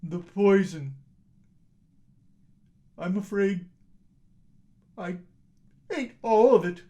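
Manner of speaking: loud, frightened admission; fearful confession. Acted. Husband tells wife he ate it, frightened. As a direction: confess you ate the poison.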